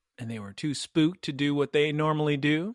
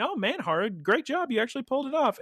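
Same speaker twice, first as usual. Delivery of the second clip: friends complimenting; 6 semitones higher, faster than usual. Retold or imitated.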